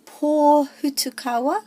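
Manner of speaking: "pōhutukawa" high and loud, long pō; stress on PŌ. Shown as a demonstration. Teacher introducing a new star, stressed long pō in "pōhutukawa". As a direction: draw out and hit the pō in "pōhutukawa". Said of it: "the pō has the emphasis".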